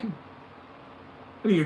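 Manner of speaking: usual speaking voice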